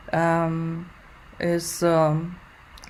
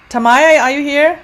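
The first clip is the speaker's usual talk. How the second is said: louder, higher call across the room; calling out loudly; 6 semitones higher, 8 dB louder than usual. Real, not acted. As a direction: call her name out loud. Teacher calls the absent student again.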